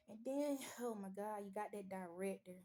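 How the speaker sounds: ordinary delivery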